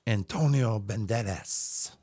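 put-on slow rolled Spanish accent. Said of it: "a little bit more Antonio Banderas".

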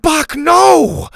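loud, very high alarmed cry. A narrator's reading. Bo stopping his T-Rex, high loud cry. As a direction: stop the dino with a sharp yell. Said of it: "Bo cried".

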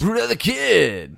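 big booming announcer read; radio host voice. Pretend. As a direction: announce it like a morning DJ. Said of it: "Sounds like a, a radio host".

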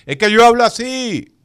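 loud, high, fast defensive insistence; mock loud defensiveness. Playing the loud friend denying he yells, mock loud defense. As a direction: raise your voice while denying you're shouting.

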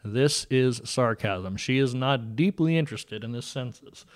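loud "This is sarcasm", every word stressed; spoken caption. Pretend. Host captioning the co-host's sarcasm for listeners, loud emphatic announcement. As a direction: punch out "sarcasm" and "not deeply interested" loudly, word by word. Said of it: "put a little caption under everything".

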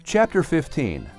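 ordinary delivery, nothing marked

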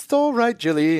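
a high, gentle soothing dad voice; daddy kangaroo. Retold or imitated. Daddy kangaroo comforts Jilly mid-tantrum, high gentle soothing voice. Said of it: "even though that's not an Aussie accent".

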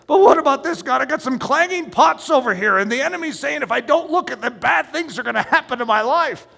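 a worried man arguing back; anxious pleading. Acted.

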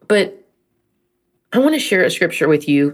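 ordinary talk, nothing marked

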